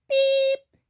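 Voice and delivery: high, loud little truck beep; truck horn beep. A narrator's reading. Blue greets the toad, high beep. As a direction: beep like a small cheerful horn. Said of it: "said the friendly little blue truck".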